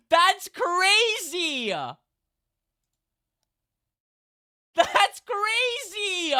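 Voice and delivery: very high, loud, very slow drawn-out cry; stunned shriek. Real, not acted. Streamer reacting to an insane roll, drawn-out shriek. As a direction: wail it in disbelief.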